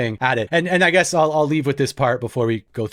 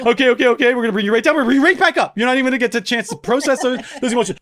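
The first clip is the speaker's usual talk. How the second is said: put-on higher and quick, hyping the kid up; frantic mock pep talk; 8 semitones higher, much faster than usual. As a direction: rush her from tears to joy. Parents' frantic rallying.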